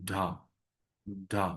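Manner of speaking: ঢা said as a breathy dha, stretched long; ঢা voiced and aspirated, drawn out. Shown as a demonstration. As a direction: hold the vowel in ঢা longer. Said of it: "ঢটাকে একটু বড় করে বলবো".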